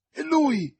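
high, loud cry of recognition; angry exclamation. A narrator's reading. Young man spotting his enemy, furious cry. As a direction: burst out, pale with rage. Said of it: "esclamò D'Artagnan, pallido di collera".

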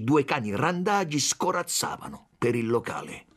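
ordinary delivery, nothing marked

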